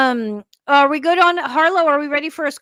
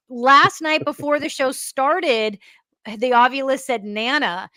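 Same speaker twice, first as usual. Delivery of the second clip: ordinary talk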